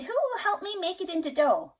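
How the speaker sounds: high hen voice calling for help; hen asking. A narrator's reading. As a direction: ask hopefully like the hen. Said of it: "She said".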